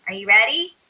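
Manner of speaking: usual speaking voice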